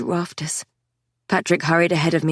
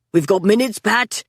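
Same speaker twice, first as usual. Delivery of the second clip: shouting; 2 semitones higher than usual. A narrator's reading. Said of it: "Scotty shouted".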